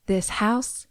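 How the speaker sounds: soft, deliberate pronouncement; quiet, measured film quote; quoting iconic line. Retold or imitated. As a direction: deliver a famous film line, hushed. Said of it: "this iconic line".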